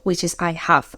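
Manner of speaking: ordinary talk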